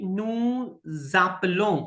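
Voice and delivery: नू जापलों slow, z carried onto जापलों; linked z sound. Shown as a demonstration. Teacher slowly modelling the linked z for students. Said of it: "ये बोल होगी ज".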